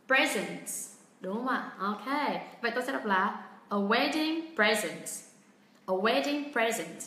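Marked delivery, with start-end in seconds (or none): 0.1-0.7 "Present" as PRE-zent, first syllable strong and falling away; stress on first syllable. Shown as a demonstration. Teacher gives learners the noun answer, first-syllable stress on "Present". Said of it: "đây là một cụm danh từ". 3.7-7.0 "a wedding present" slowly, with "present" as PRE-zent; noun stress. Shown as a demonstration. Teacher reads the noun phrase aloud, slow first-syllable stress on "present". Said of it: "vậy đương nhiên tôi sẽ đọc là".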